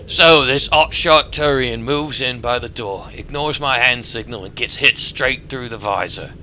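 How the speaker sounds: put-on a clumsy Australian merc accent; amateur Australian accent. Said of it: "my Australian accent sucks".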